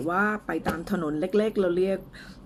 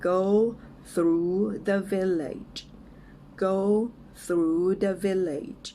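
word-by-word model for repeating, with pauses; slow and clear; 2 semitones higher, much slower than usual. Shown as a demonstration. English teacher models a phrase for learners, slow and clear. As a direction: say it slowly, each word apart. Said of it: "ช้าๆ ชัดๆ ไปก่อนนะคะ".

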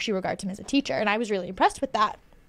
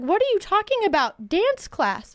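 high, incredulous disbelief; retold baffled question, high; 9 semitones higher, slower than usual. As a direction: ask it like it makes no sense at all. She is baffled.

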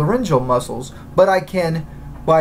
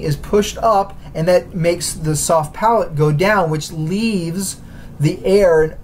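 ordinary talk, nothing marked

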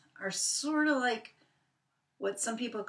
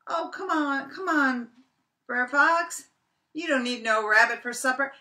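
higher, louder nervous pleading; pleading Brer Rabbit; 7 semitones higher, 6 dB louder than usual. Acted. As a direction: beg the fox not to eat him. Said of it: "And he said".

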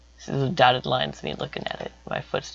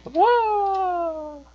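excited whoa. Retold or imitated. To viewers, squealing whoa mid-dance. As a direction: squeal it like a dance move thrill.

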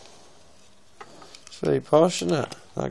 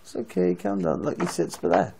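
soothing, softer; 4 semitones lower, 9 dB quieter than usual. Real, not acted. Dad soothing upset toddler.

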